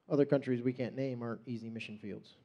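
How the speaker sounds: usual speaking voice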